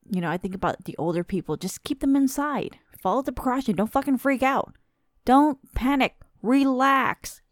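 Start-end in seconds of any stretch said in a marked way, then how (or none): 1.6-6.2 raised, higher, pleading for calm; exasperated lecture. Real, not acted. Vlogger telling panicking shoppers to stay calm, raised and exasperated. 6.4-7.4 higher and louder, a pushy command to relax; raised relax. Acted. Vlogger ordering panicking viewers to relax, loud and high. Urging panicking people to calm.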